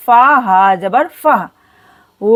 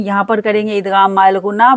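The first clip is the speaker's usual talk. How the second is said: usual speaking voice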